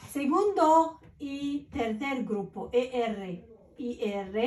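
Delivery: slow, deliberate teacher read; dictating slowly; slow dictation. Shown as a demonstration. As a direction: spell it out for the class. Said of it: "teacher dictating".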